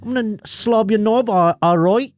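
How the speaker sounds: lightly put-on British accent, high; a hint of a flirty British woman. A hint of British accent while mimicking a British woman. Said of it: "when she starts talking British".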